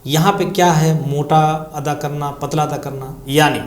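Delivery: ordinary talk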